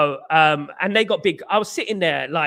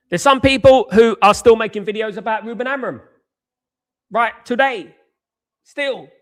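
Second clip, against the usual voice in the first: heated rant; 4 semitones higher, 5 dB louder than usual. Real, not acted. Ranting at Amorim posters.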